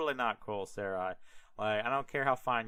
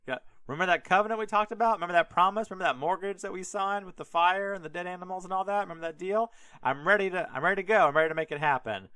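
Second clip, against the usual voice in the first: eager God; 6 semitones higher, 4 dB louder, faster than usual. Retold or imitated. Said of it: "God's like".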